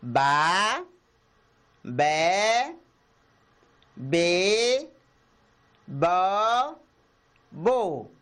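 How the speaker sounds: soletrar as sílabas Ba, be, bo devagar e claro; sílabas soletradas. Shown as a demonstration. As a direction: cada sílaba separada com pausa. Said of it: "Vamos outra vez". (sílabas lidas em voz alta para as crianças)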